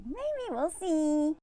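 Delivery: slow, high Southern "maybe we'll see"; mock Southern wife. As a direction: drawl it like a polite Southern no.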